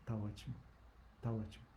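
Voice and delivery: barely voiced, tired and calm; weak, soft reply. Dying teacher reassured, answering very softly.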